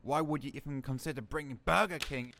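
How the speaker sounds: normal talking voice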